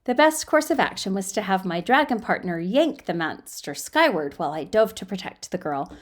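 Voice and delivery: normal talking voice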